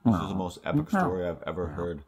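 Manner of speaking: talking while eating chips; crunching chips. Real, not acted. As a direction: mouth full of chips. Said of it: "I have chips in my mouth".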